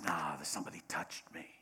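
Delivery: whispered insistence. Retold or imitated. Jesus insisting quietly to his disciples, whispered. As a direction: hush it, lean in close. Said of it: "He says".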